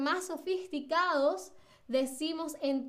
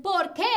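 "por qué" punched high and loud; contundente; 4 semitones higher, 6 dB louder than usual. Shown as a demonstration. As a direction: hit "por qué" forcefully, stress on "qué". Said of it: "quieren que su pregunta sea más contundente". ("por qué" contundente, con fuerza en "qué")

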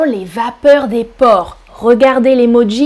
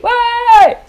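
high, happy cry of yes; excited cheer; 10 semitones higher than usual. Real, not acted. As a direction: cheer like the answer's right. Cheering students' answer.

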